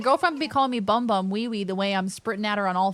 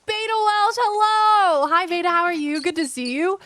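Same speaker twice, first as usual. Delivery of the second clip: high, loud hello easing down; delighted greeting; 10 semitones higher, 6 dB louder than usual. Real, not acted. Player welcoming a friend who arrives, high excited greeting.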